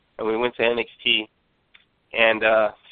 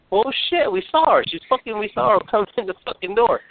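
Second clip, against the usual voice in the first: imitating an outraged coworker, pitched much higher; mock indignant protest; 10 semitones higher than usual. Coworkers insisting they saw her walk in, mock high indignant. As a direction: squeal it out in mock disbelief, a laugh slipping in. Retelling coworkers calling lie.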